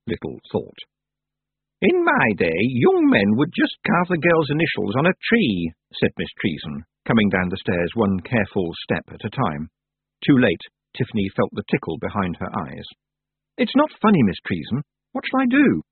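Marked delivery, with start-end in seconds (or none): 1.8-5.7 lightly voiced old witch, dry; a hint of a wry elderly witch. A narrator's reading. Old witch recalls with dry humour. 13.6-15.8 lightly voiced distressed young girl; a touch of a tearful plea; upset girl voice. A narrator's reading. Upset girl pleads with witch.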